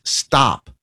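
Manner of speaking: ordinary delivery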